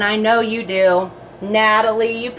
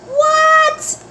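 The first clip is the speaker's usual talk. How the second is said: squeaky high What; shocked high-pitched question; surprised. Real, not acted. Surprised reaction.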